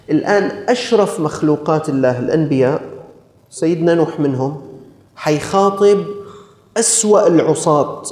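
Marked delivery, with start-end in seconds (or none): none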